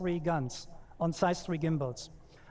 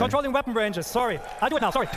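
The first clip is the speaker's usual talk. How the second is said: flustered laughing; 4 semitones higher, 5 dB louder, faster than usual. Real, not acted. Flustered after slip, audience laughing.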